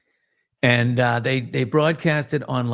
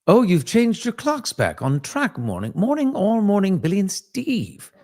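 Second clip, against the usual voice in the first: higher-pitched put-on English accent; mock English accent; 6 semitones higher than usual. Co-host reading out a listener's message, mock English accent. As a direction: read it out as a hammed-up Englishman. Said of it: "did you see what English Dick just said".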